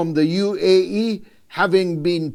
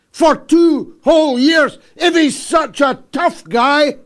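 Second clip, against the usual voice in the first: raised, high-pitched outrage; indignant tirade; 7 semitones higher, 4 dB louder than usual. Real, not acted. Commentator challenging the tough guy's inaction, indignant. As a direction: demand an answer, loud and high.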